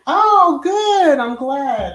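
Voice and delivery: high, loud happy oh, good; a sudden burst of real delight. Real, not acted. Reacting to a viewer's comment, high and loud with real delight.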